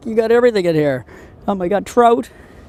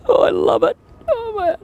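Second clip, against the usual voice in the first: excited squeal. Real, not acted. Excited over catching largemouth.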